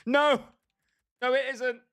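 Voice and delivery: high, loud indignant no; loud protest. Real, not acted. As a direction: shout it down in protest. Protesting a joke.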